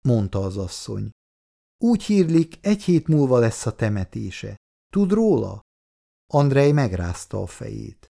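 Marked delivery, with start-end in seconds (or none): 1.8-5.6 lightly voiced older woman, a touch higher; a hint of a chatty neighbour woman, raised pitch; neighbour woman's voice. A narrator's reading. (enyhén megformált idősebb szomszédasszony hangja)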